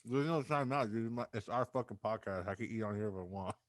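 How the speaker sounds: talking while chewing; words round a mouthful of fries; mouth full. Real, not acted. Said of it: "Are you eating chili cheese fries".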